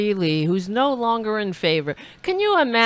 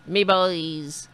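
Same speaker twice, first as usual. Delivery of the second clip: put-on Irish accent; 4 semitones lower than usual. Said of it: "I didn't know he was Irish".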